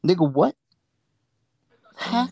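high, incredulous what; shocked disbelief. Retold or imitated. On the bus, stunned by what he hears, high shocked disbelief. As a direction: pop the eyes, can't believe it.

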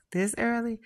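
a high, startled question; surprised. Acted. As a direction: sound caught off guard by chili. Surprised at chili.

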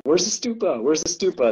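high, urgent searching call; eager searching. Retold or imitated. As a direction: call out eagerly looking around.

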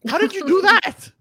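high, loud disbelief; astonished question. Real, not acted. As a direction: blurt it out, really incredulous. Reacts to cocaine-drinking claim.

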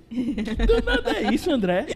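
high, surprised scolding mother voice; a mom aghast at her son's new word. Retold or imitated. Mother asking her son where he learned that word.

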